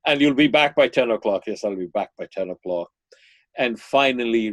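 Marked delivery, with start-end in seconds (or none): 0.1-1.4 loud, brisk motherly condition; mother extracting promises. Mother to son about his curfew, firm demand. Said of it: "she extracts all kinds of promises". 1.5-2.9 quick, lower, dutiful echo; son promising compliance. Retold or imitated. Son to his mother about curfew, dutiful promise. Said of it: "she extracts all kinds of promises".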